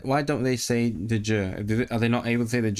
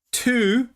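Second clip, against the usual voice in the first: "two" high, louder and drawn out; stressed TWO; 13 semitones higher, 5 dB louder than usual. Real, not acted. Telling chat it was two videos, stress on "two". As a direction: punch "two" high in disbelief.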